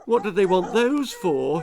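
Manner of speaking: mild frightened outcry; worried fox crying out; alarmed cry. A narrator's reading. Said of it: "he cried".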